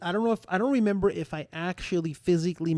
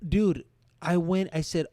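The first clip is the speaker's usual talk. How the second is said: ordinary talk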